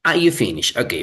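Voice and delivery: ordinary delivery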